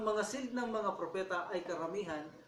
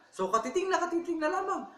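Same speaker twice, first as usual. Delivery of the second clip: high, loud, eager suspense; rising thrill of almost-certain belief; excited build; 11 semitones higher, 6 dB louder than usual. A narrator's reading. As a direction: lean in, so close now. Building suspense before the seal.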